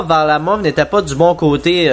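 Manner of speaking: normal talking voice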